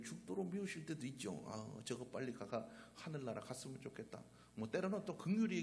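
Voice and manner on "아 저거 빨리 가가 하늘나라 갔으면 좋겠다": grumbling aside. Retold or imitated. Wife grumbling about husband.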